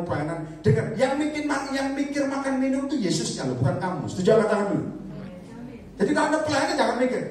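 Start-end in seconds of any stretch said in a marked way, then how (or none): 5.9-7.2 emphatic preaching. Real, not acted. Preacher rising, fast.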